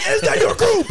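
very high sergeant's yell, imitated; drill sergeant. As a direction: scream the question like a drill sergeant. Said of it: "drill sergeant looking dude up front".

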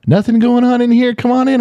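high-pitched mock call through a door; pitched-way-up retold holler; imitated yelling. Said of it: "And you're yelling".

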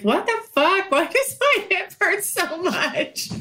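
high, baffled, half-laughing; shocked confusion. Retold or imitated. Waking up sore after rough sex, retelling her own high, baffled, laughing surprise.

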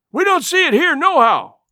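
high, loud shouted answer; called-back reply. A narrator's reading. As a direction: shout it down to the street. Man calls back from upstairs.

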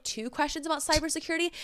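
ordinary talk

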